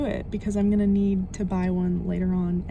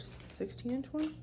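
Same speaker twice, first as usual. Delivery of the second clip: usual speaking voice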